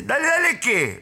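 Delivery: high, heated retort; high-pitched acted aggression; mock angry challenge. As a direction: throw the words back in irritation. Acted stranger challenges him.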